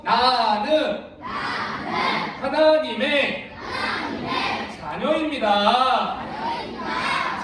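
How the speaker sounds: very slow, stretched-out recitation; call-and-repeat declaration. Shown as a demonstration. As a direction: lead children to repeat, drawing out each word. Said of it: "큰 소리로 따라해보겠습니다". (따라 하도록 길게 늘여 선포)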